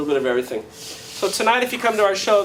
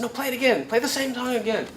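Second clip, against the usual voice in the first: higher-pitched mock audience demand; insistent crowd; 7 semitones higher, 5 dB quieter, faster than usual. Audience orders the guitarist to repeat the song, insistent. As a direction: imitate a crowd pressing for a replay.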